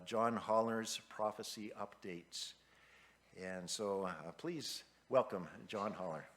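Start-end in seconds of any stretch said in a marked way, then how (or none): none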